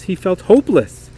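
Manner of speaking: ordinary delivery, nothing marked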